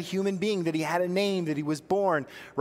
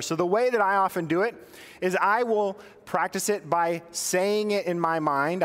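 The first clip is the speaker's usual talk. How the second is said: usual speaking voice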